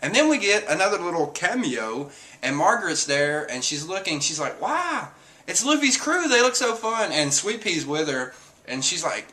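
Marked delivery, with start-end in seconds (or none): 4.6-7.1 put-on high-pitched girlish marveling; imitating a wide-eyed admirer; mock wonder. Voicing Margaret marveling at crew.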